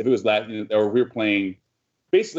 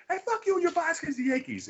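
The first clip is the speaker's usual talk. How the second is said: much higher, softer mocking heckle; high mock Yankees fan jeering; heckler voice; 5 dB quieter than usual. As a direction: taunt him in a high put-on voice.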